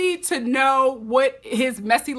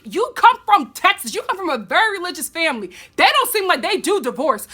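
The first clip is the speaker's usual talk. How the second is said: sassy scolding friend; 6 semitones higher than usual. Retold or imitated. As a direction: scold her like an exasperated best friend. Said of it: "And I'm like".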